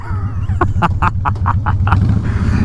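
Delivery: real laugh at own story. Real, not acted. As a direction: laugh helplessly mid-story.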